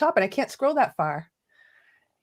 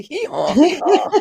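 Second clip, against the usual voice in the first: donkey bray; 6 semitones higher, 7 dB louder, slower than usual. Acted.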